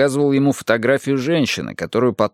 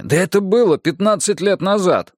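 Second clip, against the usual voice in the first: higher, exclaimed protest; 9 semitones higher than usual. Acted. Erik objects that the study was long ago, protesting. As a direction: push back in a raised pitch. Эрик возражает собеседнику.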